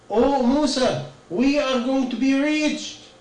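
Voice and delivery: high, slow, pleading cry of alarm; frightened plea. Acted. The frightened people begging Musa as the sea blocks them, high and alarmed. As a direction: sound panicked, begging to be saved.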